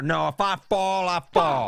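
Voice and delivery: cranky, drawn-out mock old-man voice; grumpy grandpa. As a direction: imitate grandpa stubbornly refusing help. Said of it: "my grandpa's grumpy".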